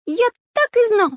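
very high, loud cartoon voice; alarmed outburst. A narrator's reading. Character reacts to hanging robbers.

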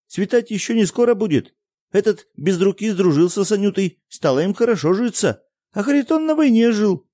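put-on high, loud earnest boy; boy's voice. A narrator's reading. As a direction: tell it like an upset child talking fast. Boy's long speech in child voice.

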